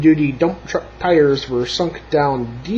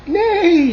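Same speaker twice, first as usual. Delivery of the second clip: high, loud falling neigh; whinny it up high and let it fall; horse neigh; 5 dB louder than usual. A narrator's reading. Horse greeting Blue, high loud neigh. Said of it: "said a horse".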